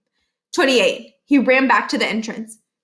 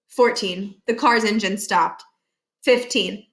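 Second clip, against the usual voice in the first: ordinary delivery, nothing marked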